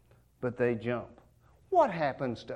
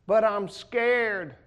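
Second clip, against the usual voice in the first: higher, slow, drawn-out whine; scared child; 6 semitones higher, 5 dB louder, much slower than usual. Pretend. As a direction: stretch out the fear like a small kid. Child pleading again.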